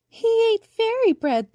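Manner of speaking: very high, loud, slow teasing voice; giggling daisies. A narrator's reading. Daisies laugh at the shrunken boy, high giggling voice. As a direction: tease in a high giggly squeal. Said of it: "they giggled".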